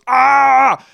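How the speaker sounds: high-pitched yell imitating a madman; mock yell. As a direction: let out a mock "Ah!" scream. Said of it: "He just yells at some".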